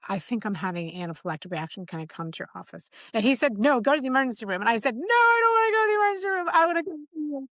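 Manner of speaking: normal talking voice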